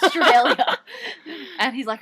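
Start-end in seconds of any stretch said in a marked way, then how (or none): 0.0-0.8 loud, high yell; hammed-up patriotic shout; retold emphatic correction. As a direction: belt out your country's name in mock outrage. Retold proud correction of New Zealand.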